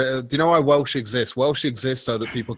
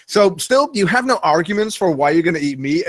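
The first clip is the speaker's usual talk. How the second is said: mock vegan critic; 6 semitones higher, 4 dB louder than usual.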